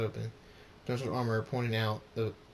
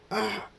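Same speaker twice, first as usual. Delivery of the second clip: high startled "ah"; sudden high cry; shorter scream; 10 semitones higher, 3 dB louder than usual. A narrator's reading. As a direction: yelp in shock.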